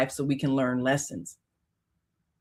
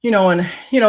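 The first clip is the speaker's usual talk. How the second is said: normal talking voice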